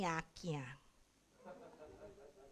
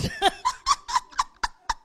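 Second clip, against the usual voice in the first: high laugh; 4 dB louder than usual. Real, not acted. Laughing at own story.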